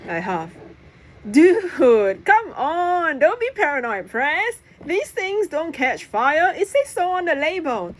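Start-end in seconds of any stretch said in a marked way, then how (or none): 1.3-7.9 dismissive, pitched up; flatly. Acted. Jack waving off Press's smoke fear, dismissive. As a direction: brush off a worried friend. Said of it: "I say flatly".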